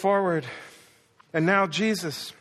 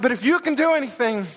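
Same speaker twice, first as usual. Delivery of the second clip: higher, urgent pleading outburst; blurted plea; 5 semitones higher, 4 dB louder than usual. Acted. Desperate father begging Jesus for help, blurting it out. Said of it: "he just blurts out".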